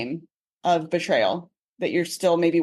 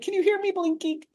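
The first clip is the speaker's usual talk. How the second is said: husband's joke voice; 11 semitones higher than usual. Retold or imitated. Husband joking to his wife about blinking, high mock voice. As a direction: ham up his running joke.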